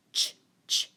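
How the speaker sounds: "ch" as short voiceless bursts; crisp isolated "ch" with no vowel; CH sound. Shown as a demonstration. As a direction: sound out "ch" twice, separately. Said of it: "C H, very good".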